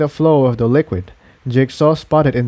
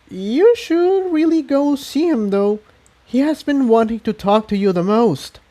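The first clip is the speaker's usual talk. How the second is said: much higher mare voice; lightly voiced pony; 11 semitones higher than usual. A narrator's reading. Tiptoe urging Incendia to see him, higher. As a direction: urge her kindly.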